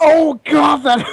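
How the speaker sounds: high, loud panicked cry; shout. Acted. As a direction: yell it out in alarm. Acting out the punch.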